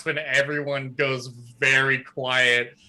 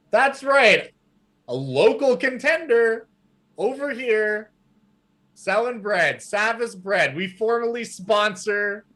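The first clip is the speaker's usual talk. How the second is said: announcer from a box; 8 semitones higher than usual. Acted. Savis on a box introducing his sponsored girl, high proclaiming. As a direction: proclaim to the crowd.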